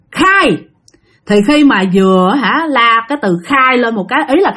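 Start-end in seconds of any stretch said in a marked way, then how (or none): 0.1-0.6 high clipped order; a touch of the shaman's voice; stern command. Retold or imitated. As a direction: command the spirit to speak. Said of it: "nói rằng".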